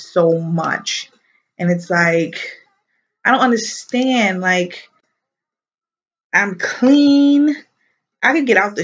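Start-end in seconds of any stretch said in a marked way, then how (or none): none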